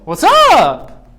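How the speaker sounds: loud, high, stretched-out greeting; exaggerated high pitch. Shown as a demonstration. Lecturer demonstrating inflection to students, high and loud. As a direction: shoot the pitch up and draw it out.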